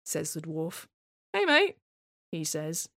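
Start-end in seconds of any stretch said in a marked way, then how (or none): 1.3-1.8 voicing the husband. Husband voiced greeting dwarf.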